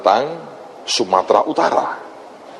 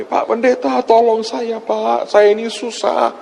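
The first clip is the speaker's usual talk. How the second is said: high-pitched imitation of a distressed woman caller; imitated wailing plea; 8 semitones higher than usual. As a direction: plead high, as if calling out for help. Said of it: "dia teriak dengan saya". (menirukan ibu yang memohon dengan suara tinggi)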